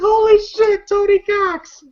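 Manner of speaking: high-pitched astonished cry; amazed exclamation. Retold or imitated. Fan recounting a surprise cameo, high amazed exclamation. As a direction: exclaim it high, in amused disbelief. Teller reacts to seeing Tony Cox.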